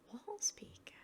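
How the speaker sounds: puzzled whisper. Real, not acted. Repeats item name, puzzled.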